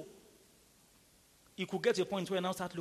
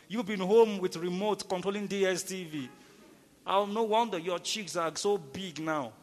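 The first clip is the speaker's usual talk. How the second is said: teasing preaching; 2 semitones higher, 7 dB louder than usual. Acted.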